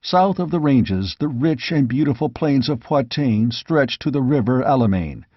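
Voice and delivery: usual speaking voice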